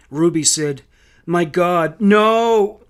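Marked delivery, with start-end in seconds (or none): 1.2-2.8 anguished disbelief, swelling to a loud high NO; distraught outcry; horrified cry. A narrator's reading. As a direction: wail the final word. Mother learns children are dead, faints.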